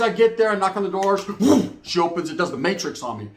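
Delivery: ordinary talk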